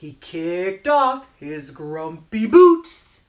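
slow, stretched-out storytelling with punched key words; children's story narration. A narrator's reading. Storyteller reading about a grumpy penguin, animated narration. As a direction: draw out the phrases for small children. Reads picture book to children.

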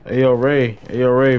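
usual speaking voice

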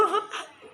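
a sudden high burst of laughter. Real, not acted. Laughing about an odd foreigner. (หัวเราะเสียงสูงออกมาทันที)

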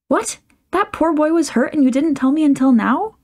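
aghast that nobody told her; startled, scolding disbelief; shocked aunt. Acted. Auntie shocked at the news.

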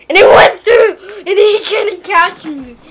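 loud, high burst through laughter; laughing excitedly. Real, not acted. Player retelling a narrow escape, laughing excitedly. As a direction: tell it loudly, laughing hard.

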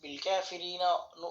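ordinary talk, nothing marked

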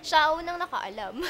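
laughs out loud after the words; laughing. Real, not acted. Recalling mother knowing first, laughing. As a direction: breaks into a high laugh. Laughing while telling story.

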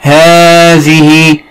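"هذه" drawn out very slowly, the long first vowel sounded clearly; stretched pronunciation demo. Shown as a demonstration. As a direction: stretch "هذه" so the unwritten alif is heard. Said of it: "لاحظ النطق ولكن لا نكتبه". ("هذه" ممدودة ببطء شديد لإظهار الألف التي تُنطق ولا تُكتب)

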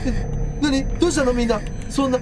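usual speaking voice

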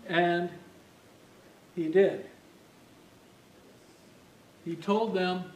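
ordinary talk, nothing marked

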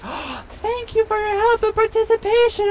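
grateful rat. Retold or imitated. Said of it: "And they would be like".

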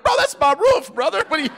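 shocked owner yelping at the ruined roof; outraged homeowner. Retold or imitated. Homeowner to the men breaking his roof, high indignant yelp. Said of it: "who owns the house is like".

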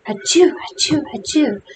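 spoken mock sneezes, first ones pitched high; high-pitched storybook achoos; acted sneezing fit. A narrator's reading. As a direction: sneeze it out in words, one after another. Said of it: "had another sneezing fit".

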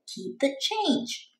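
put-on a gentle old grandpa. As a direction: hand over the tip gently. Grandpa gives a big tip.

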